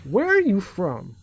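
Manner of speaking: lightly mimicked southern lady, drawn out; slow the question, pitched a little up; older southern lady. Older lady questioning a teen, lightly imitated southern drawl. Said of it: "one of the older ladies".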